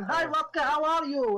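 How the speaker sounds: high, hammed-up Dutch accent; mimicked Dutch accent. Director greeting actor, mimicking his Dutch accent. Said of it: "I was mimicking what I was going".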